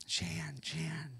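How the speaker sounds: hushed whispered name; whispered call. Pretend. God whispering a listener's name.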